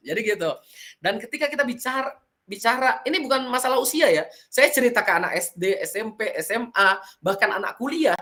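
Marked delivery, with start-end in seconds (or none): none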